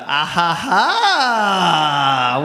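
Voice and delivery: long, drawn-out theatrical "ah ha ha"; mock triumphant laugh. Comic answering someone stuck in the bath, mock laugh. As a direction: laugh it out slowly, swelling on the last. Acted smug reply in French bit.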